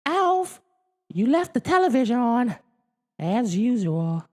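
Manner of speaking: put-on very high, loud girl's voice; Kate calling. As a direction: call out, a bit cross. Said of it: "Kate called".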